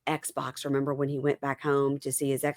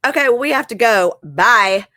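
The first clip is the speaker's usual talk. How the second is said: mock-annoyed, louder and higher brush-off; pissed off; 6 semitones higher, 8 dB louder than usual. Savannah leaving the session pissed off, retold with mock annoyance. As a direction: imitate someone cutting a meeting short, pitched up and loud. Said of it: "She was straight pissed off".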